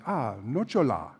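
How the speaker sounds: Italian "nocciola", stress on the middle "cio", rolling to the end; Italian accent. Retold or imitated. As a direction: imitate the Italian gelato server saying "nocciola". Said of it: "The accent is toward the end".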